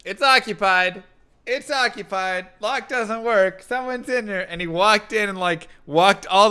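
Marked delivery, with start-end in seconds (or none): 0.0-4.5 raised, higher mock yell through a bathroom door; retold yelling. Man on the toilet yelling at an intruder, raised mock yell. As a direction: call out that the stall is taken, loud and insistent. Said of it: "And I kept yelling at him".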